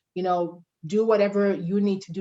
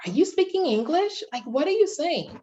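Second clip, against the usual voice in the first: higher, incredulous mock question; mock baffled listener; 8 semitones higher than usual. Mimicking people who claim they can't understand an African accent, incredulously. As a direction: play the puzzled, condescending listener. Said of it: "everybody wants to make you seem as though".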